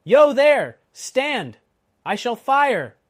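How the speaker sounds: very loud, high threatening shout; roaring challenge. A narrator's reading. Guard to rider in the mist, roared threat. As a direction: bellow a warning to halt. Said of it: "as loud as he could roar".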